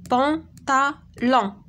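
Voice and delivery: بونطالون split into three beats, a clear gap after each syllable. Shown as a demonstration. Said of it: "دابا غادي نشوفو في اين سيلاب". (بونطالون مقطعة سيلاب بسيلاب)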